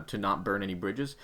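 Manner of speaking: ordinary talk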